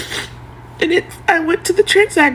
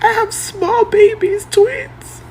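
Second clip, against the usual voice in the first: crying; 5 semitones higher, slower than usual. Real, not acted. Mother pleading to viewers, voice breaking in tears. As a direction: voice breaking high on twins, slowed. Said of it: "Why are you crying?".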